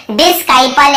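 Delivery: डिस्काइपल with a K after the S; wrong hard-C pronunciation. Shown as a demonstration. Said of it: "केवल डिसाइपल पढ़े".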